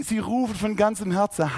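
normal talking voice

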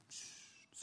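whispered shush; drawn-out quiet shush. Real, not acted. Hushing the children.

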